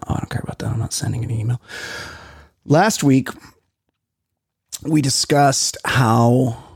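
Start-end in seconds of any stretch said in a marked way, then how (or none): 0.1-1.6 half-whispered aside; muttering to himself. Real, not acted. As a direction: mumble it under your breath.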